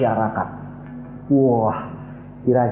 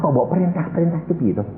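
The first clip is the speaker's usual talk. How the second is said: normal talking voice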